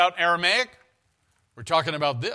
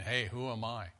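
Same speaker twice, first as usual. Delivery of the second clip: much softer, lower humble plea; humble and quiet; 5 semitones lower, 9 dB quieter than usual. Retold or imitated. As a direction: hush down like a modest servant. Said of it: "He's saying".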